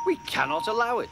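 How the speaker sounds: high, rapid protest; indignant. A narrator's reading. Offended engines object to rudeness, indignant. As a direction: declare it quickly in high-pitched outrage.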